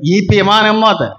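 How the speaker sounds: normal talking voice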